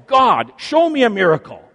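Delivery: loud high shout for a miracle; man shouting at God for a sign. A narrator's reading. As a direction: bellow it up to heaven. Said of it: "the man shouts".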